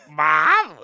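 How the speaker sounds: embarrassed son yelling. Retold or imitated. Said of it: "He was like".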